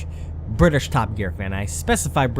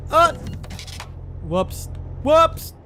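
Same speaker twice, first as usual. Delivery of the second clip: high startled yelp; startled whoops; much slower than usual. Real, not acted. Crash in game.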